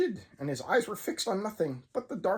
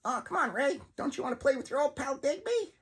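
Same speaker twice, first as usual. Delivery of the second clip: puppet with dark malice; 7 semitones higher than usual. Acted. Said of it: "tone of dark malice".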